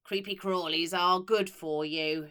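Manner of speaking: loud, slow, gloating witchy matron; creepy matron chuckling over cockroaches; chuckling cruel matron. Acted. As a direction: relish it like a nasty old headmistress. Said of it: "she would chuckle".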